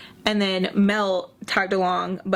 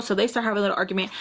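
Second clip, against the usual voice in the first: usual speaking voice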